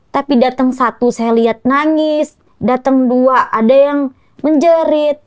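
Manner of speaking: ordinary talk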